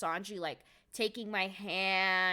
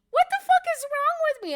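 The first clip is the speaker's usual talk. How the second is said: very high, loud self-mocking squeal; embarrassed shriek; 9 dB louder than usual. Real, not acted. Scolding herself to viewers for freezing, a very high shriek. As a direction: shriek at yourself in disbelief. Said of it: "I'm usually so cool".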